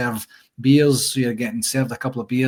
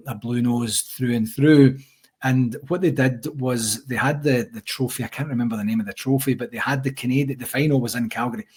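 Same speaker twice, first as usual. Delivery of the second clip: ordinary talk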